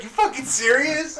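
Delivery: high incredulous outburst; disbelief. Retold or imitated. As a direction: sound like you can't believe it. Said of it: "I was like".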